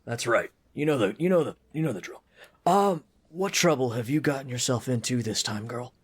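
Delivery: put-on friendly but gruff old dwarf; Priest Deno. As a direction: grumble like a busy, kindly old dwarf. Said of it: "a friendly, but gruff voice".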